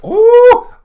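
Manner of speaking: sudden high, loud cry of shock; a loud, high gasp-yell; shocked yelp. Real, not acted. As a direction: burst out in disbelief. Shocked by the news story.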